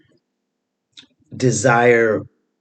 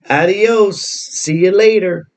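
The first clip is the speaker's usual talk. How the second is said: breezy sarcastic goodbye, higher and louder; mock farewell; 6 semitones higher, 3 dB louder than usual. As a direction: wave them off cheerfully, tongue in cheek. Mock farewell to deported criminals.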